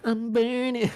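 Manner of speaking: high movie quote. Retold or imitated. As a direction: squeal it like the film. Quoting Rush Hour line.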